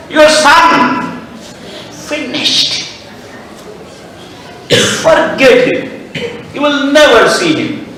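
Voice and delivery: high, conspiratorial plotting voice; a schemer stoking the queen's fear, pitched high; scheming servant. Acted. Scheming servant poisons Kaikeyi's mind.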